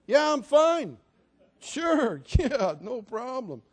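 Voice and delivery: high, loud brave front with a laugh; hammed-up "I'm fine" through a nervous laugh; forced cheerful. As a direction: cover the hurt with fake brightness. Hurt suitor pretending he's fine.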